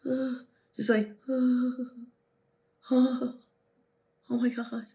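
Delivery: put-on anxious boy fumbling for words; timid stammer. Shown as a demonstration. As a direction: shrink into nervous stalling. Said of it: "timid energy".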